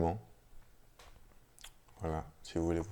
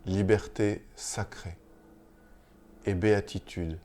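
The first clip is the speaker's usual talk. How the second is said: measured, solemn reading of a mystical chant; poem recitation. Acted. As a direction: recite line by line, with long pauses between phrases. Lit un chant mystique à voix haute. (lecture poétique, lente et solennelle)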